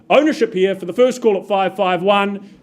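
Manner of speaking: normal talking voice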